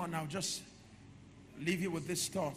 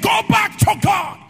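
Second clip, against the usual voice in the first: high, full-force shout; mother's words proclaimed at the top of the voice; shouted exhortation; 13 semitones higher, 16 dB louder, slower than usual. Retold or imitated. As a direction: cry it out to the whole church.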